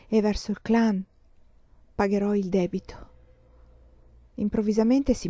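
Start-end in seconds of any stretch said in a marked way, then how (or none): none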